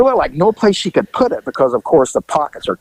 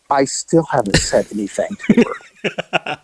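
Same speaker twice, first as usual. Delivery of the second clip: retold protest; 3 dB quieter than usual. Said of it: "Mac is going".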